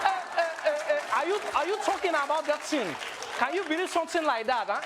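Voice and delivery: high, indignant Nigerian accent. Shown as a demonstration. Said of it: "when Nigerians come inside".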